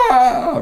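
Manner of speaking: a short, sudden, very high mock yell of panic; mock freak-out yell. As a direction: blurt out a high mock cry as if losing your cool. Acting out freaking out.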